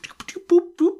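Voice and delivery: high beeping sound effects; interface noises. Retold or imitated. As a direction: chirp like a hologram screen. Mimicking a holographic interface.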